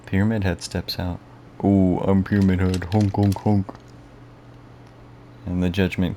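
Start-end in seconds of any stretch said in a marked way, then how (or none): 1.6-3.7 goofy honking clown voice; silly clown. Pretend. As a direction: play it dumb and goofy, honking. Jokey Pyramid Head impression.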